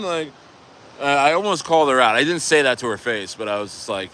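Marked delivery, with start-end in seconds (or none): none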